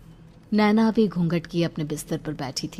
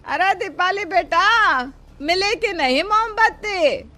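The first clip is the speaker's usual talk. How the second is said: much higher, loud, drawn-out call; mother calling; 5 dB louder than usual. Acted. Mother asks Dipali about the candles, calling very high. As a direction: call from the next room, impatient but kind.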